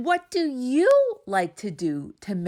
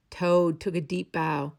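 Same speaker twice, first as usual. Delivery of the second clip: plain reading voice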